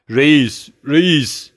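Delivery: high, loud cry for the chief; desperate shouting of "Reis, reis!". A narrator's reading. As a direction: call out to the bandit chief in despair. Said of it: "diye bağırdı".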